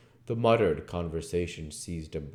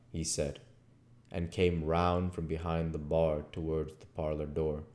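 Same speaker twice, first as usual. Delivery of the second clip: ordinary talk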